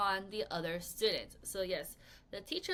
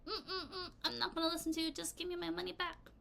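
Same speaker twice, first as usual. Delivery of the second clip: snooty, higher voice with a laugh; mock snobbish customer, higher and laughing; uppity woman; 6 semitones higher, 6 dB quieter, faster than usual. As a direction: play the haughty customer demanding her money. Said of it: "She's like".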